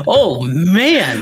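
ordinary talk

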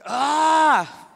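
sudden high frustrated "Ah!"; frustrated groan. Retold or imitated. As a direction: burst out in annoyance. Stressed father groans.

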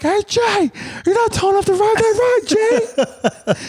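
very high squeaky mocking taunt; sing-song the taunt way up high; yelling across the room. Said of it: "I'm like".

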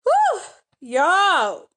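real pained exhale from burning eyes; onion-stung eyes. Real, not acted. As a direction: let out a sharp whew as the eyes sting. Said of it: "My eyes is burning".

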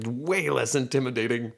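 usual speaking voice

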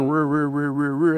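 imitated engine cranking; starter cranking. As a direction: grind like a starter that won't catch. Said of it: "mostly meant going".

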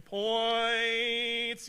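drawn-out, loud mock emphasis; hammed-up overemphasis; mock exclamation. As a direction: stretch it out like six exclamation points. Mocking heavy punctuation.

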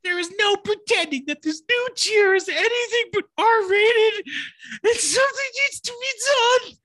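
high, shrieking outraged complaint; whiny outraged rant. Acted. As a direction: rant like a furious annoyed customer. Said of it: "Read it in the bitchy voice".

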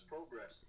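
"progress" sounded out as PROH-gress, long o; "progress" with "pro" as in "protein"; sounding out words. Shown as a demonstration. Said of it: "If you sound it out".

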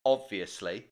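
low, rude, deadpan reply. Pretend. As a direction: dismiss him flatly. Rude boss on phone.

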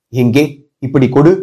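offhand low demand with a touch of urgency; feigned indifference, slight agitation. A narrator's reading. Commander demanding the letter, offhand yet slightly agitated. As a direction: act indifferent, let slight eagerness slip through. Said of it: "அலட்சியமாய் கேட்டபோதிலும் அவருடைய குரலில் சிறிது பரபரப்பு".

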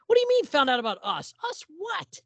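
high, fast, baffled husband; mock-baffled husband squeaking the question; baffled reply. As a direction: toss it off in high disbelief.

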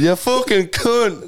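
high, drawn-out Irish insult; exaggerated mock Irish accent. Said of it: "That's what she said".

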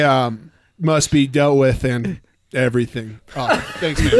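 bro voice. Pretend. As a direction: play a laid-back gym bro. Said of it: "Can you say it with the bro voice?".

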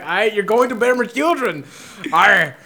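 hammed-up Irish, turning piratey; over-the-top fake Irish accent; bad Irish accent. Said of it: "went from Irish to pirates".

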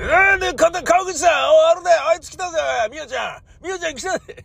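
tip off your buddy that she's here; excited festival guy. Retold or imitated.

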